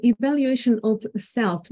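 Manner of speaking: normal talking voice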